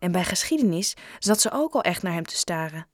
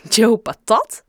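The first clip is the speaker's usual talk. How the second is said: loud, astonished echo question; stunned, questioning the name; dumbfounded; 3 semitones higher, 9 dB louder, slower than usual. A narrator's reading. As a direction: repeat the name in disbelief, rising at the end. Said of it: "zei ze stom verbaasd".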